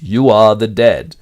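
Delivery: "You" stressed, slow, loud and metallic; cold machine echo from the wall. Acted. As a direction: hidden telescreen speaking, metallic and slow. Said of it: "a metallic voice behind them repeats".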